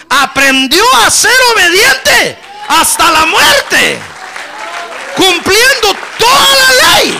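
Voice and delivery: high, shouted, drawn-out preaching; full-force preaching. Real, not acted. Preacher exalting Christ's obedience to the congregation, full-force shouting. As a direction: cry it out to the congregation, stretching the words.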